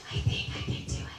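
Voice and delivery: whispered, unvoiced little voice; quiet whisper. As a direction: whisper it like a shy small girl.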